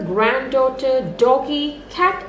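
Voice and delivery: usual speaking voice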